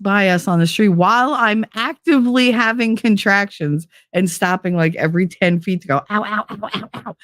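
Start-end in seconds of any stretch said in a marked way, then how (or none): 6.1-7.2 soft, pained little ows. Retold or imitated. Walking to the hospital mid-contraction, soft and pained. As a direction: wince through each step.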